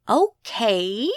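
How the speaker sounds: a slow Southern drawl on Okay. A narrator's reading. Skeet agrees to listen, in a slow Southern drawl. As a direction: stretch Okay out lazily, Southern style. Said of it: "he drawled".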